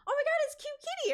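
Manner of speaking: high, fast squeal of a thrilled fan; excited fan. Retold or imitated. Fan spotting the cosplayer in the hall, high excited squeal. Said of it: "there was a handful of times where it was like".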